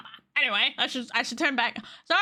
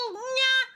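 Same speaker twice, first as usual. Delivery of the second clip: cute cat cry. Acted. Excited cry in model.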